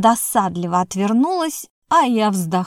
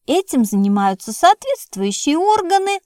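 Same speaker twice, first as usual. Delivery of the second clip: higher, blurted out fast; 7 semitones higher than usual. A narrator's reading. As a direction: blurt it out in a rush. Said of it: "выпалила Муза". (выпалила, выше)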